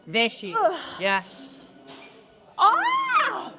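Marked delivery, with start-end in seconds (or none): 2.6-3.5 high, drawn-out cry of exertion; pained gym yell; strained yell. Real, not acted. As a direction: cry out as the last rep ends. End of a hard set.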